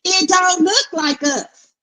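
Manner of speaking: high, slow, mocking the boy mom's whine; mocking the mother. Viewer mocking the TV boy mom, high and slow. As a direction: drag it out high like a disbelieving mama. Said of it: "mother's line in the show".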